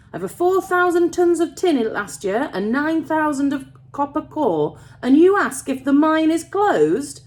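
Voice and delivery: put-on loud, high, indignant old miner; passionate, almost angry. A narrator's reading. Old miner defending his working mine, passionate and almost angry. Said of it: "He spoke passionately now, almost angrily".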